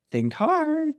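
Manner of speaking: high, singsong creature warning; Sphinx warning. Retold or imitated. Sphinx warning Oedipus as he ponders, high-voiced. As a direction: pitch it way up, teasing out the threat.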